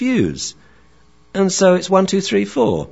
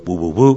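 short run of imitated woofs; quick mock dog barks; barking along; 2 semitones lower, 4 dB louder than usual. Shown as a demonstration. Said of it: "bark along with the dog".

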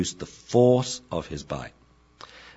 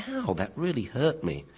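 higher, softer pretend hurt; mock yelp; 6 semitones higher, 6 dB quieter than usual. Shown as a demonstration. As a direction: fake being hurt. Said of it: "We're gonna yelp with the harder bites".

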